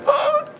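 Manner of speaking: very high gasp; mock gasp. He retells being thrilled by the pop star's message with a high gasp. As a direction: gasp like you're starstruck.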